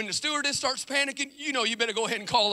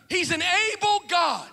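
"able" stretched slow and high, shouted; stress on able; 8 semitones higher, 5 dB louder, slower than usual. Shown as a demonstration. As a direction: lean hard on "able", slowly. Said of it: "Let me say it one more time".

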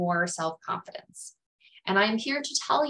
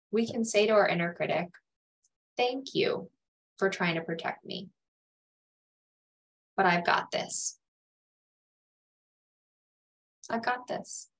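sincere. Shown as a demonstration. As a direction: gently reassure your own worried voice. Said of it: "with sincerity".

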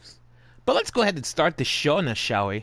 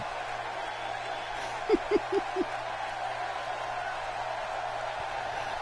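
high breathless laugh; 10 dB quieter than usual. Real, not acted. Laughs after own hype.

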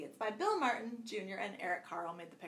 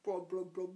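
soft bubbly blubs; fish blub; 4 semitones lower, 4 dB quieter than usual. Shown as a demonstration. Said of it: "What would they say".

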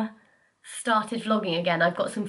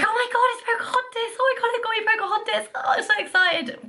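much higher, fast gleeful squeal; squealing excitement; faster than usual. Retold or imitated. Girl realising her Christmas present, high fast excited squeal. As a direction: shriek with delight like a kid who guessed. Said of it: "And so I was like".

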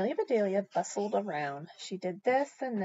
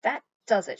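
loud exasperated outburst; short shout; 3 semitones higher, 8 dB louder than usual. A narrator's reading. Mister Rogers at the end of his patience, shouting. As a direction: burst out loudly, fed up. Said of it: "shouted Mister Rogers".

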